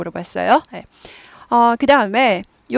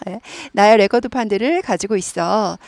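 normal talking voice

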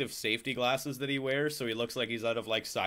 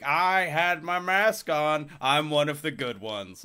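drawn-out, louder opening, mockingly pleased with oneself; mock self-righteous; 4 semitones higher, 3 dB louder, slower than usual. Mask wearer boasting at home about being good, mock self-righteous. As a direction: put on a smug do-gooder, stretching the words.

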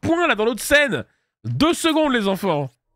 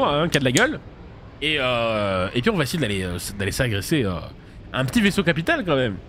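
ordinary delivery

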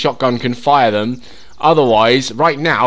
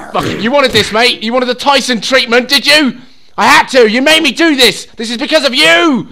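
loud, raised taunts mid-fight; mock-angry shouting; 10 semitones higher, 6 dB louder than usual. Player punching a zombie he blames, shouting. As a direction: rant it at your opponent, building louder.

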